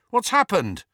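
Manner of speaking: high call of alarmed question. A narrator's reading. Harry to his searching friend, calling out. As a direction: call the question through the house. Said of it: "he called".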